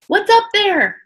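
loud, high call up the cliff; shouting up. Acted. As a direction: call up to someone out of sight. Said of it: "Jack shouted".

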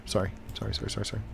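soft, repeated muttered sorries; hushed little string of sorries; quick apology. Real, not acted. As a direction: apologise softly under your breath. Apologising under their breath while playing.

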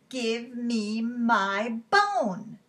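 slow, drawn-out spooky call; storybook voice from the closet, moderately loud. Acted. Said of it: "called out a teeny tiny bit louder".

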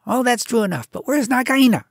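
wary, businesslike little mongoose; Rikki Tikki. A narrator's reading. Mongoose asks the bird about Nagaina, wary.

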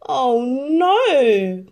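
high, loud horrified cry; dismayed cry. Acted. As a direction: duke sees the slipper shatter. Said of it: "cried the Grand Duke".

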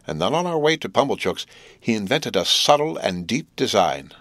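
ordinary delivery

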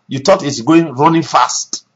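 ordinary talk, nothing marked

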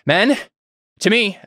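high, loud rallying call; commanding shout. Acted. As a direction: call the men to you. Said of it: "and he says".

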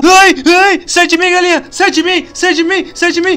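high, loud terrified cries for help; panicked screaming. Acted. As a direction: scream in panic as chickens attack. Screaming in a chicken nightmare.

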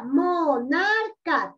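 "monarca" very slow, in three separate syllables. Shown as a demonstration. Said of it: "¿Cuántos tiene?".

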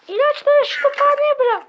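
high, loud put-on voice; squeaky character voice. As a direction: play a shrill cartoon kid. Character voice, far timbre.

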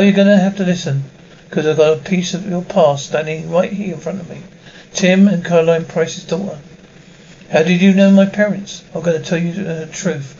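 ordinary talk, nothing marked

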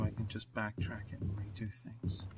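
soft breathy aside; whispering. Real, not acted. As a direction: whisper it like a secret. Aside about saving.

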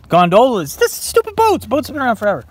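loud high outraged complaint; high loud indignation; indignant protest. Real, not acted. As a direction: rant at the trick question. Protesting the trick question.

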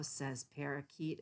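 ordinary delivery, nothing marked

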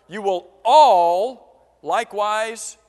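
"all" stretched out long and loud; slow right down and boom out "all"; stress on all. Shown as a demonstration. Preacher quotes Jesus after asking for the word, stressing "all". Prompts congregation for next word.

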